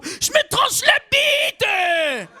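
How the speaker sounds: high, drawn-out shriek; crazed man with a knife, shrieking; frantic shout. Acted. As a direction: scream it out, stretched and wild. Acted character with knife.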